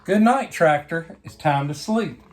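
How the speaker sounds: ordinary delivery, nothing marked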